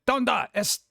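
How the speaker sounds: high-pitched, yelled-sounding Spanish; mock yelling. Imitating Spanish class with a German teacher, mock yelling. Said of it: "it sounds like I'm yelling at them".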